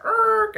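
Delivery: sudden high loud brake screech; a sharp squealing erk. Retold or imitated. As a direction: imitate slamming on the brakes with your voice. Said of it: "I hit the brakes".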